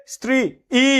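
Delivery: স্ত্রী broken off before a lone ই; separately sounded vowel. Shown as a demonstration. Teacher asking if anyone talks so, ই sounded apart. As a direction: say স্ত্রী, pause, then a long extra ই. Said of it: "ই কি আলাদা উচ্চারণ করবা".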